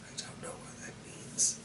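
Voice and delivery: unvoiced whisper under the breath; whispered aside. Real, not acted. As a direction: mutter the confession in a whisper. Aside about odd word.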